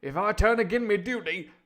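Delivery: put-on high, loud, impassioned old-film sailor; hammed-up indignant sailor; defiant honest sailor. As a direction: declaim it as a loyal seaman standing firm. Repeats the sailor's line.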